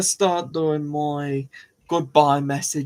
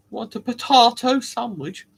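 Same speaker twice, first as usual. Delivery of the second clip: higher mock-posh offer, "potahto"; 9 semitones higher, 4 dB louder than usual. Mocking posh potato eaters, mock-posh question. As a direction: put on a posh accent.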